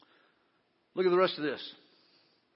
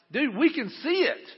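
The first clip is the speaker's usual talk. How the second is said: higher, drawn-out retold exclamation; enthusiastic reply; 6 semitones higher than usual. Pastor replying to newly baptized woman backstage, enthusiastic. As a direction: act out an excited reply, pitched up and slower.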